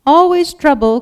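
high, loud bleating complaint; bleating sheep. A narrator's reading. Sheep complain about Daisy going missing, loud high bleating. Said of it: "came several more bleats".

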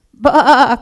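loud, high wavering baa; loudest possible bleat. A narrator's reading. Lost sheep calls to a distant voice, full-force bleating. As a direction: bleat as loud as you can. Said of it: "as loud as she possibly could".

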